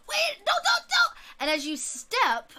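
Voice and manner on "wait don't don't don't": urgent warning. Acted. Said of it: "Swampy's like".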